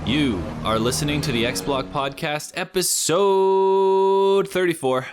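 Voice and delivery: slow drawn-out hype announcer; excited hype intro. Acted. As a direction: stretch the episode number out big. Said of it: "the most eccentric, excited intro".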